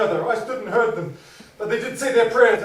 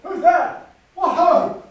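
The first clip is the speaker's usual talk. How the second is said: higher, louder call into the dark; 7 semitones higher, 5 dB louder, slower than usual. Acted. Husband calling out into the dark, high and loud. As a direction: challenge an unseen noise.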